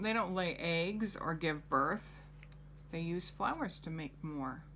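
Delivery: ordinary talk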